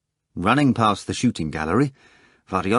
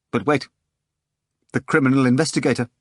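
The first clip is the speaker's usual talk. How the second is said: hesitant; 4 semitones higher, faster than usual. A narrator's reading. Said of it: "added hesitantly".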